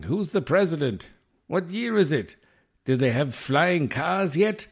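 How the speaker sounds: high, slow, bewildered hermit voice; a hermit stumbling into the sunlight, asking everything; hammed-up Grizzly Adams hermit just out of the woods; dazed mountain man. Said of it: "that's the kind of accent I'm gonna have".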